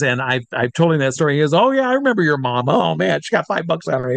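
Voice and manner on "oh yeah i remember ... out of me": higher, animated imitation of a friend reminiscing; Penn Jillette. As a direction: play a delighted friend remembering. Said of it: "Penn and I are friends".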